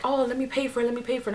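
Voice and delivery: a hint of soft, high-pitched coaxing; imitating pleading friends. Voices friends offering to pay.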